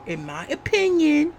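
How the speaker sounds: OPINION pitched high and held up; stress on OPINION. Real, not acted. Vlogger repeats the keyword for viewers, high stress on "opinion". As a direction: hit "opinion" high, as a pointed disclaimer. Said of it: "keyword here".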